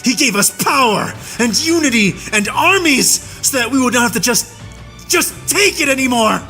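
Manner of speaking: high, fierce war-cry defiance; a human soldier vowing revenge on his captor; vengeful fury. A narrator's reading. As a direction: declare the hunt with burning hatred.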